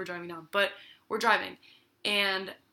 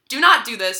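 louder, emphatic imitation of dad; retold stern warning; 3 semitones higher, 11 dB louder than usual. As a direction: voice dad's forceful warning in retelling.

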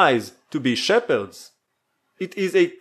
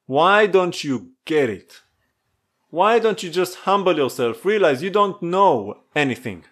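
ordinary talk, nothing marked

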